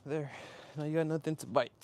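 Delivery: normal talking voice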